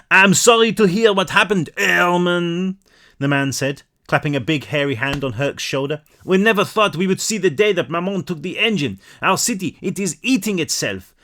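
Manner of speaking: put-on grave condolence in a heavy French accent. Baker sympathising with Herc, French accent. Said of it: "My accents are amazing".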